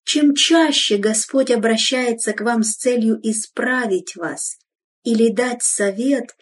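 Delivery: usual speaking voice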